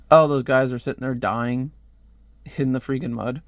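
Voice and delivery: ordinary delivery, nothing marked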